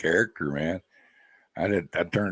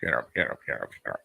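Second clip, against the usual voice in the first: squawky parrot squeak, fading low; parrot imitation; 6 semitones lower, 4 dB quieter, much faster than usual. Owner mimicking his pet bird saying shut up, parrot squawk. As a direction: mimic the bird's clipped shut up. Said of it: "he says "shut up" a lot".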